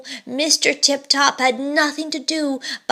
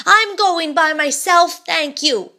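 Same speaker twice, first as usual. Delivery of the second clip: frightened; 6 semitones higher, 4 dB louder than usual. A narrator's reading. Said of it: "gasped poor Mister Tip-Top".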